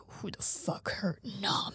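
hushed, seething near-whisper; really mad. Retold or imitated. Zoro learning that Nami was hurt, quietly furious. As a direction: drop to a soft, menacing whisper. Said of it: "then he's really mad".

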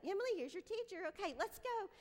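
put-on high-pitched, rushed hustling of kids; rushed, high mock-chirpy mom; hurried frazzled mom. Frazzled mother hurrying her kids along.

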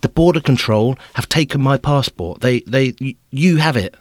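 imitated agitated insistence; flustered insisting. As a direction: push the point, getting flustered. Insists to official.

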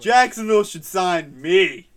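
put-on loud, drawn-out drunken declaration; slow, booming drunk boast; mock drunk bravado. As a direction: declare it slowly and loudly, playing hammered. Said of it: "Blake would say some stuff like that".

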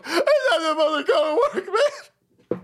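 high, tearful fake wail; mock sobbing. Host mimicking people who resent work, mock sobbing. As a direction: bawl it like a hammed-up crybaby. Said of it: "These motherfuckers sit there and go".

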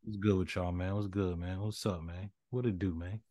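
dropped to a low, quiet voice; talking low. Real, not acted. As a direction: hush it down to cover up. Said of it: "started talking low".

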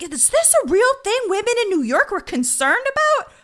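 high, loud incredulous outburst; baffled and comically appalled. Acted. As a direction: blurt it out in high-pitched disbelief. Host acts out her baffled reaction.

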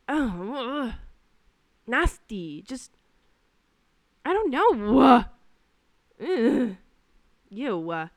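loud groaning disgust. Real, not acted. Server disgusted by older guest, groaning. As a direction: recoil in disgust.

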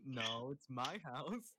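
slow, laughing mock claim; taunting a small cousin over the house; teasing insistence. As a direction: tease the little girl slowly. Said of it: "I always make the point".

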